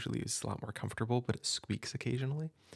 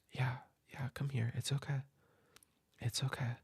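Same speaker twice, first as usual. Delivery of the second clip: hushed comfort. Acted. Soothing crying kid in hug.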